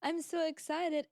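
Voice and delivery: high, giddy thrilled voice; retelling her joy at being invited, in mock excitement. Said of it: "I was like".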